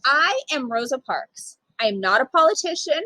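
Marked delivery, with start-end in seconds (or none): none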